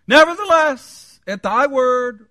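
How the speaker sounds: high, booming, drawn-out words; loud acted declaration. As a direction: proclaim it slowly at full voice. Acting Peter's grudging reply to Jesus.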